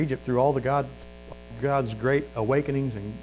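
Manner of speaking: ordinary talk, nothing marked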